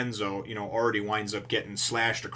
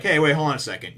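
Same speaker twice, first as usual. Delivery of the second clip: loud protest; 6 semitones higher, 9 dB louder, faster than usual. Retold or imitated. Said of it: "And Genzo's like".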